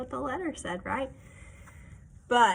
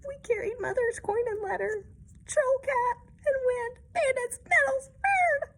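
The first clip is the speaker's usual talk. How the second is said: tearful kittens; 13 semitones higher than usual. Acted. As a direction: blurt the troubles out like an upset kitten. Kittens tearfully list their troubles.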